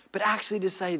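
ordinary delivery

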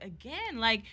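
high-pitched, emphatic re-enacted scolding; acting out an exasperated telling-off; retold indignant rant. Retold quarrel, confronting boyfriend.

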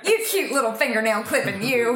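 playful grandmotherly fussing; doting Southern grandma. Acted. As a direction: coo a silly pet name like a Southern grandma. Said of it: "Southern grandma thing where you go".

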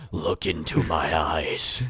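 low, slow eerie toy voice; disembodied voice. Pretend. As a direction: intone it like a haunted toy. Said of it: "you hear a disembodied voice say".

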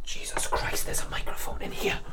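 breathless unvoiced whisper of discovery; shocked whisper. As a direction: whisper it so the bug can't hear. Discovers hidden microphone.

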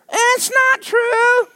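very high, slow taunting voices; mock the preacher like a taunting spirit, slow and high; taunting demon voices. Preacher imitating demons taunting him, very high slow voices. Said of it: "I would hear".

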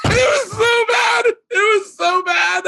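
squealing high, slow, laughing too hard to talk; helpless laughter. Real, not acted. Comic recalling a disastrous hosting job, laughing helplessly. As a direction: wheeze the words out in real hysterics.

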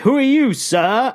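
high-pitched, exaggerated British challenge. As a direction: demand it in a hammed-up British accent. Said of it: "it's, like, the most British way".

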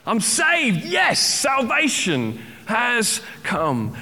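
relieved cheer at a life ring; mock joyful shout. Preacher acting the man thrown a life ring, hammed-up relief. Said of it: "throw you one of the life rings".

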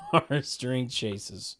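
usual speaking voice

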